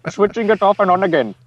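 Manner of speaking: mock tech-support Indian accent. Friend continues the tech-support bit in Indian accent. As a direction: ask it like a call-centre agent. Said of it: "[name] does a good one".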